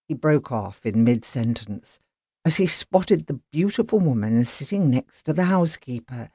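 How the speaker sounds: usual speaking voice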